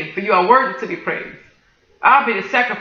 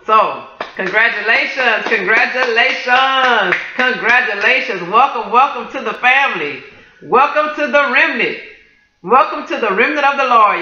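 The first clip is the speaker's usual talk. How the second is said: drawn-out, raised, celebratory welcome; joyful welcome; 3 semitones higher, slower than usual. Real, not acted. Preacher welcoming new believers, slow and joyful. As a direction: welcome them into the family with joy.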